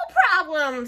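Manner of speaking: high, loud witch answer; breezy hag. A narrator's reading. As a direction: tick it off the list gleefully. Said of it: "said Gritch".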